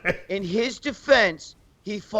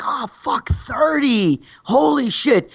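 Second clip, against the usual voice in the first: mock shock; 5 semitones higher, much slower than usual. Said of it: "I was like".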